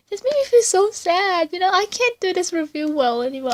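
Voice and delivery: quick, high voice close to tears; choked up, apologising; emotional. Real, not acted. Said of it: "It just makes me so emotional".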